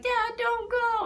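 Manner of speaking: put-on high, slow little-girl plea; child pleading. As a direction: beg a leaving father to stay. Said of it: "And she was like".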